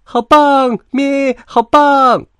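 very high, loud lamb squeals; cheering. A narrator's reading. Little lamb celebrates the move, cheering. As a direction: whoop slowly with a bleat between cheers. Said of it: "小羊羔欢呼".

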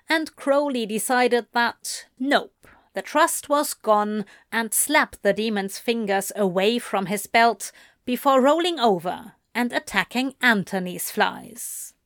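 ordinary delivery, nothing marked

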